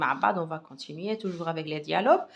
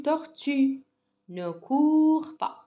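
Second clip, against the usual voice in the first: "tortue" and "court" pitched higher and louder; stressed model syllables; 7 semitones higher, 4 dB louder, much slower than usual. Shown as a demonstration. Teacher stresses the sounds for the pupils, slow and loud. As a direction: lean on "tortue" then drag out "Ne court pas". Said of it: "Où est le ou ou le u?".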